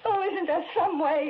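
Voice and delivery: high, loud and crying; a sobbing, pleading cry; crying plea. Acted. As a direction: break into tears as you beg. Desperate plea about dead husband.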